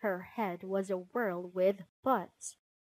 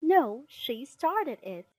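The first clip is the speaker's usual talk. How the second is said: put-on slightly raised, slow child's protest; louder, higher quarrelling retort; mild indignant cry; 5 semitones higher, 8 dB louder, slower than usual. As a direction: cry out the blame, lightly acted. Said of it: "Cries of".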